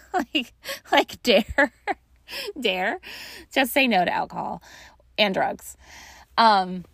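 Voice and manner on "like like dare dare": laughing. Real, not acted. Laughing about the DARE slogan.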